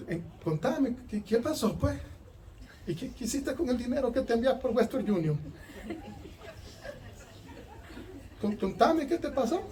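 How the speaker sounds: soft sweet-talking lord, laughing; mock tender hushed questioning; mock gentle coaxing. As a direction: coax softly like a doting parent. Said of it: "¿Usted cree que le estaba hablando así".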